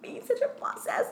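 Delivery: high, soft fake-tearful brag; mock sob punchline. To Sponsor Daddy, high fake-tearful punchline. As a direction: deliver the twist still pretending to cry. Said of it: "it gets him every time".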